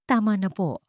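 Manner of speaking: usual speaking voice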